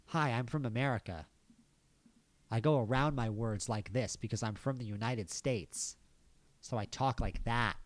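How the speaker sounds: hammed-up American accent; mock American accent. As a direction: put on an exaggerated American voice. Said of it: "I have an American accent".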